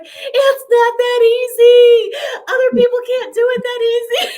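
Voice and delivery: mock outrage dissolving into laughter; laughing protest. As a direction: squeal out the protest while laughing.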